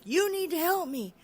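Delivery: high, loud retold outburst; insistent cry for help; desperate demand. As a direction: shake them with your voice, pitch way up. Shakes him, demanding help.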